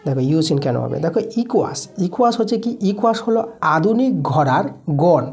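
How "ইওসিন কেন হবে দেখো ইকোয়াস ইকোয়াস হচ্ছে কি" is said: ordinary talk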